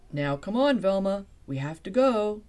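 teacher calling loudly across the room. Acted. Teacher calling Velma to leave. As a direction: raise your voice to hurry her along.

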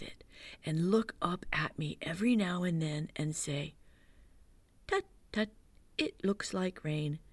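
lightly voiced Pooh, hushed and thoughtful; Pooh's hushed voice. Pooh explaining honey plan to Christopher Robin, hushed.

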